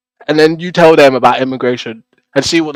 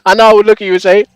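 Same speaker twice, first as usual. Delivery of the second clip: usual speaking voice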